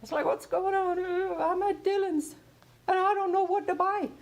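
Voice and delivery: high, lost voice of a stuck shopper; helpless caller. Retold or imitated. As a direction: play a woman frozen in the store, helpless. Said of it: "And she said".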